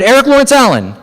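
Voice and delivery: loud, very high imitation of a mother; mother yelling. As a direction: holler it like an angry mom down the street. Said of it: "my mother yell down the street".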